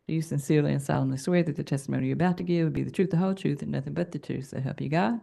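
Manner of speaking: rattled off fast, by rote; rote court oath. Real, not acted. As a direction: rush through the oath by heart. Commissioner swears in witness panel.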